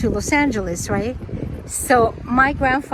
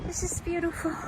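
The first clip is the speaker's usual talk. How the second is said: hushed awe; 5 semitones higher, 12 dB quieter than usual. Real, not acted. Awed by the lake.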